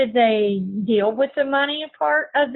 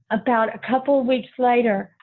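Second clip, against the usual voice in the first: normal talking voice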